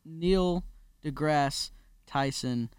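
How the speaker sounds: Neil deGrasse Tyson said very slowly, word by word; slow, deliberate reverence on the name; drawn-out name. Real, not acted. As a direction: space out Neil, deGrasse and Tyson with weighty pauses. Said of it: "say his name like that".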